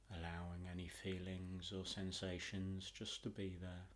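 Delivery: soothing relaxation voice, long pauses between phrases; guided meditation. Acted.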